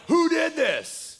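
hammed-up angry crowd demanding answers; outraged shout. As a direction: bellow the question like an angry mob. Philistines demand who burned fields.

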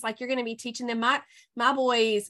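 normal talking voice

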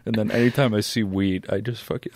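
usual speaking voice

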